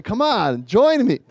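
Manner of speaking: high, pleading retold coaxing; begging. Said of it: "I practically begged her".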